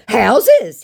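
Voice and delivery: high, loud, drawn-out brag; mock boastful scammer. Scammer bragging about cars, mock boastful. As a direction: puff up the boast in a put-on voice.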